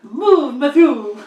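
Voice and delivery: very high-pitched mock-French diva; French accent. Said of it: "Only in a French accent".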